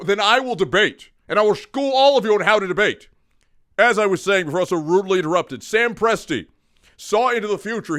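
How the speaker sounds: lively mock speech, pressing each point; mock oratory. Host lecturing his co-hosts as if in a debate. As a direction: orate like you're schooling the whole panel.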